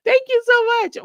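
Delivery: loud, very high-pitched gush; excited thanks. Real, not acted. Host thanks viewers after hitting the milestone, excited. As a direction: burst out with gratitude, high and loud.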